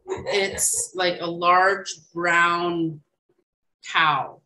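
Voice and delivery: slow and deliberate, weighting large; slow emphasis. Shown as a demonstration. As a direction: lean slowly on large brown. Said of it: "explaining meaning slowly".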